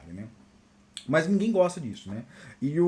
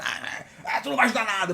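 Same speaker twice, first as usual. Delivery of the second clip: put-on scoffing colleague, a bit higher; mocking dismissal; 4 semitones higher than usual. Colleague refusing his help, mock scoffing voice. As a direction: imitate a scornful colleague.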